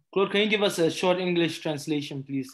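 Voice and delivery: ordinary talk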